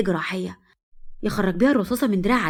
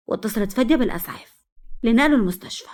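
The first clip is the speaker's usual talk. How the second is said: ordinary delivery, nothing marked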